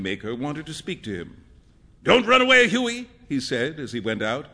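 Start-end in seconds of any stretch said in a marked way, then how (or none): 2.0-3.1 high, loud parting call. A narrator's reading. Painter to friend as he went out, calling. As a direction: toss it over the shoulder, raised voice.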